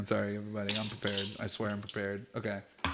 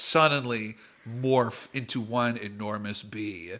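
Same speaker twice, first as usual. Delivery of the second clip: ordinary talk, nothing marked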